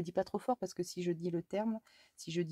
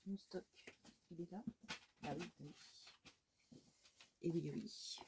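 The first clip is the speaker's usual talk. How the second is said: whisper; 10 dB quieter than usual. Real, not acted. Whispering aside to herself.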